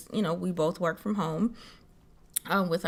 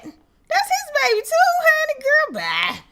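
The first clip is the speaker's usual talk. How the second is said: exasperated outrage; 5 dB louder than usual. Acted. Outraged retort to nosy people.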